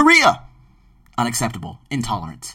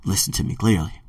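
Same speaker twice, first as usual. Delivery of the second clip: normal talking voice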